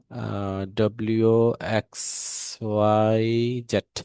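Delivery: slow, drawn-out English letters one by one; English alphabet recital. Shown as a demonstration. Teacher slowly reciting the English alphabet for comparison. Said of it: "এটা তো আমাদের ইংলিশের উচ্চারণটা".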